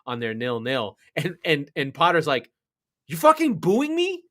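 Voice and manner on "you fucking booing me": loud, high furious mock tirade; angry rant. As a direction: blast the booing crowd like a fed-up coach. Coach Potter rants at booing fans.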